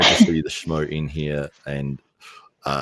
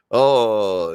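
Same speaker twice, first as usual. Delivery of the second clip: ordinary talk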